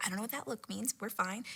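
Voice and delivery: very quiet reassurance to self; hushed retold thought; nervous self-talk. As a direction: say it under your breath.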